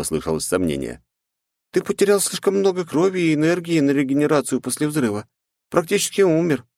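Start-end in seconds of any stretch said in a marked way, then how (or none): 1.7-6.6 higher, lightly voiced helper; a gentle AI companion's doubt; Mai voice. Mai questioning her master. Mai's worried warning.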